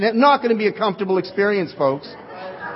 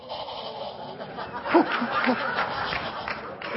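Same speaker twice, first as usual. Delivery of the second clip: breathy laugh; 3 semitones higher, 9 dB quieter than usual. Real, not acted. Laughs at neurosurgeon answer.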